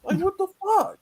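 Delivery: high, squeaky disbelief; outraged disbelief; incredulous. Real, not acted. Outraged at ruined steak.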